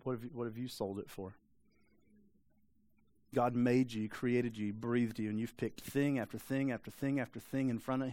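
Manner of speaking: low, soft, sober preaching; quiet rebuke. Real, not acted. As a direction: drop the voice and confront them quietly. Sober rebuke after a long pause.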